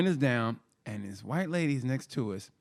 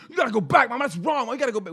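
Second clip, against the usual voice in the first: put-on high, loud pleading kid; brother pleading; 10 semitones higher, 5 dB louder, faster than usual. Brother pleading with Mama to go back, high and loud. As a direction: insist like a little brother. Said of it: "And my brother".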